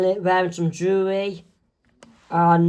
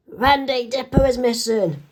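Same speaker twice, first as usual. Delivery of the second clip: urgent news; 6 semitones higher than usual. Acted. Mabel tells Wendy urgently.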